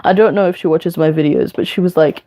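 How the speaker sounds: usual speaking voice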